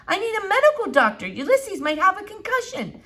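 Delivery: urgent Flora. A narrator's reading.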